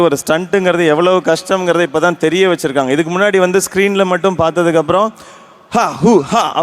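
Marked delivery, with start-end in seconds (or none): 5.7-6.6 quick mock stunt grunts, pitch bouncing; imitated fight noises. Actor showing how easy screen stunts looked, mock fight noises. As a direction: toss off short movie-fight cries. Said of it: "அப்படின்னா stunt முடிஞ்சிருச்சு".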